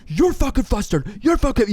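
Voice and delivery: high, loud heated yelling; mock screaming match. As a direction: yell it like a fired-up acting exercise. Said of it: "we're screaming at each other".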